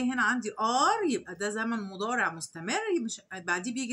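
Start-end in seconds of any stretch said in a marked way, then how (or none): none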